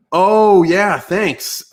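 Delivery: startled realisation, then thanks; sudden surprise. Real, not acted. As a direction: caught off guard by a comment. Suddenly notices comment.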